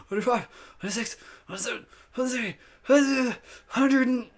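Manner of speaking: high, effortful counting through a stretched smile; squeaky coach holding a hard smile; straining through exercise. Real, not acted. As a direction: push through the last reps, pitch way up.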